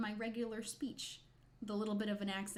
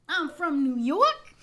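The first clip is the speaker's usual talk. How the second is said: loud, drawn-out, higher New York bellow; over-exaggerated New York accent; 6 semitones higher, 13 dB louder, slower than usual. Streamer announcing her roots in jest, loud exaggerated New York accent. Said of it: "doing a really over-exaggerated version of it".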